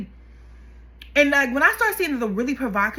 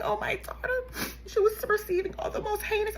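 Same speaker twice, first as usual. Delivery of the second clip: high, soft mock sob; mock crying; 10 semitones higher, 6 dB quieter than usual. Mocking the mother crying in the documentary, mock sob. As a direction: imitate her fake crying. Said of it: "this heifer is crying".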